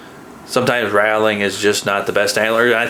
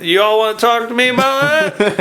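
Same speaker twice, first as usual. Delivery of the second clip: high-pitched mock challenge; deer picking a fight; 10 semitones higher than usual. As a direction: act out an indignant deer, pitched way up. Voicing a challenged deer, acted.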